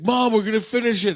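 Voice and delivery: high, loud, exasperated protest. Retold or imitated. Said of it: "you're raising your voice at your mom".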